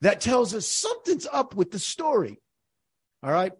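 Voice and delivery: normal talking voice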